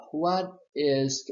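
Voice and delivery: normal talking voice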